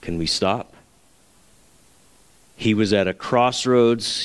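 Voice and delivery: ordinary delivery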